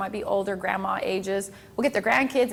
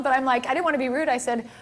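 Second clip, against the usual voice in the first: usual speaking voice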